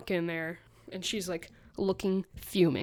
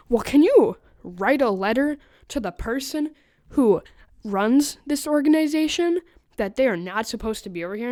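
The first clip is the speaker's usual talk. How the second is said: loud mocking demand; teacher pressing the counselor, loud and pushy; 4 semitones higher, 10 dB louder than usual. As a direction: push hard for the letter.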